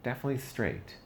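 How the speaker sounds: "definitely" jumps high, then falls; emphatic first take. Retold or imitated. First take of the verdict on his dad, pitched high. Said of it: "I was like".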